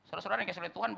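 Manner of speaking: ordinary talk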